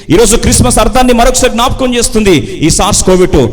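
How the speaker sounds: high, loud, impassioned sermon cry; full-force preaching. Acted. Preacher challenging people laughing outside but crying inside, full force. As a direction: confront the congregation with ringing force.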